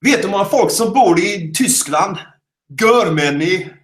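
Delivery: high-pitched Gothenburg lilt; Gothenburg accent. Shown as a demonstration. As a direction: tell a bad joke in Gothenburg accent. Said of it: "in order to do Gothenburg".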